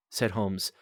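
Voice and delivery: usual speaking voice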